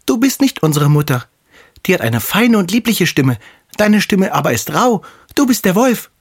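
put-on high, accusing kid-goat voice; little goats calling out the impostor; defiant little kids. A narrator's reading. (trotzige Geißlein mit hoher Stimme)

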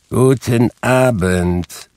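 slow, drawn-out, pointed good evening; insistent dismissal. A narrator's reading. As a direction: show him the door, word by word. Scrooge repeats dismissal more insistently.

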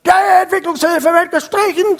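very high, loud scream; shrieking threat from an angry delegate. Retold or imitated. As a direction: scream it like a madman at the gate. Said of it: "schrie der".

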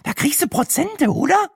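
very high, squeaky question; eager. Acted. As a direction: angle for a discount excitedly. Brenda eagerly asks about discount.

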